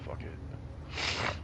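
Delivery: low whispered fuck it; muttered. Real, not acted. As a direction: mutter it under your breath. Gives up after being scolded.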